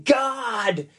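mock cry to God, high but not loud; retold crying out. Preacher voicing Jesus crying to his Father, high retold cry. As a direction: call out in anguish, held back in volume. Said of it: "crying with a loud voice saying".

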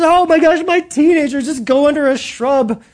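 high, loud frantic mother; mock panicking mother. Mother panicking over her teenager, mock frantic and high. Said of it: "She says".

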